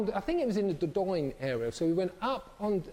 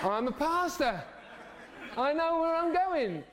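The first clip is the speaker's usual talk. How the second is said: lightly voiced, laughing self-assured pastor; a hint of a swaggering pastor, half laughing; confident pastor boast; 8 semitones higher than usual. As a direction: play the cocky pastor with a laugh in it. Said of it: "suddenly said".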